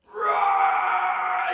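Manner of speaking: high, loud screaming roar; lion roar. Retold or imitated. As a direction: let out a huge roar. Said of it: "He roared".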